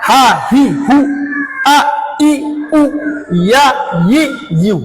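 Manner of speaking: very slow, stretched Arabic syllables; teacher stretches each Arabic syllable for the class; drawn-out recitation. Shown as a demonstration. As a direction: draw each sound out long like tajweed practice.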